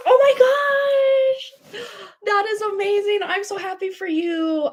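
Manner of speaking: high, delighted cry of joy; excited. Real, not acted. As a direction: gush over the engagement news. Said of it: "that's so exciting".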